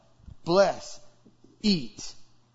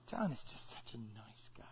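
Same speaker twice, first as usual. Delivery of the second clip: 1 whisper; 9 semitones lower, 19 dB quieter than usual. Acted. Friend confiding about John.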